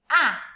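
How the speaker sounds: short open ah, said loud and clear; short a sound. Shown as a demonstration. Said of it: "Say it".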